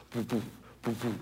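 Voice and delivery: soft, spaced puffing sounds; hushed little poofs; imitated video-game puffs. Imitates slowed video-game ball.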